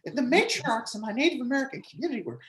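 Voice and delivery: high, hammed-up Warren voice; Warren impression. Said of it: "can you do a Warren?".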